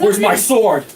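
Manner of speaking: high, loud character outburst; demanding shout. Acted. Waking warrior demands his lost weapon, loud demanding shout.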